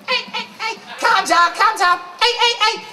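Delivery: high-pitched, exaggerated Scouse accent; hammed-up squawky Scouse; mock Scouse impression. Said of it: "try and do, "There's Scouse voices".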